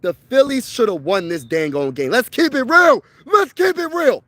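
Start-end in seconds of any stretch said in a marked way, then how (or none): 2.1-3.0 loud, higher exclamation; really letting it rip; worked-up shout. Real, not acted. Fan venting about the Phillies' loss, loud and worked up. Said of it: "I'm a little worked up". 3.2-4.2 much higher, strained cry; worked-up squeal. Real, not acted. Fan venting about the Phillies' loss, squealing high and strained. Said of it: "I'm a little worked up".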